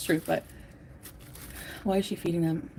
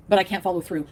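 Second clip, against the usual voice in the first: ordinary talk, nothing marked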